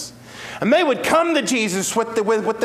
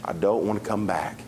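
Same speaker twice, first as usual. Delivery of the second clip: much lower and softer, very slow at the end; a lightly voiced deacon in heaven, softly declining to return; content departed deacon; 12 semitones lower, 7 dB quieter, slower than usual. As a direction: gently refuse to come back, settled and at rest. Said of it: "what Brother Donald would have said".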